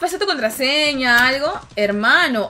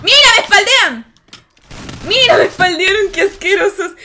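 loud, higher indignant yell with a laugh at the end; outraged shout; 6 semitones higher, 7 dB louder than usual. Real, not acted. Gamer shouting at enemies who ambushed her from behind, outraged. Getting shot from behind in-game.

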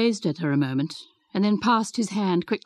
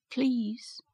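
a soft, hesitant please, much quieter; timid please; 4 semitones higher, 7 dB quieter than usual. A narrator's reading. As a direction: she barely dares to ask. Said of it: "began Mary".

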